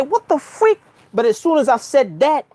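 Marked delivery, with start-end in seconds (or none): 0.0-0.8 high startled yelp of what the freak. Retold or imitated. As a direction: jump up in pitch on What the freak, caught off guard.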